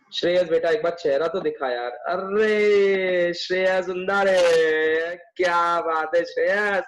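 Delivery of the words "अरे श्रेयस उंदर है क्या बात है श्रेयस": drawn-out delighted greeting. Real, not acted. Teacher hails a student finally seen on camera, drawn-out delight. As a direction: stretch the vowels out long, slowly.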